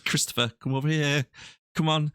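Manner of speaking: mock carer calling. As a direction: call him back gently like a lost granddad.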